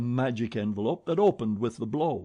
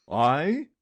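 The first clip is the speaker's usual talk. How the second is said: astounded; 4 semitones higher, 7 dB louder than usual. A narrator's reading. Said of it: "asked Richard, astounded".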